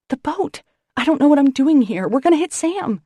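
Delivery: high, loud panicked yelling; panicked shout. Acted. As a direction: yell in panic at the wheel. Panicking at the wheel.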